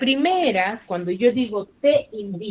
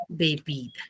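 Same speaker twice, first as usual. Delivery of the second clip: ordinary talk, nothing marked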